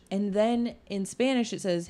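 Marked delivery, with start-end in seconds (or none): none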